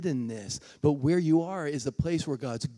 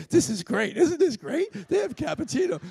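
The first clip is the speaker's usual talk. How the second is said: high, loud, laughing gush; can't contain the thrill; gushing excitement; 12 semitones higher, 5 dB louder than usual. Retold or imitated. Gushing to grumpy seatmate.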